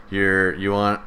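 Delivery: ordinary talk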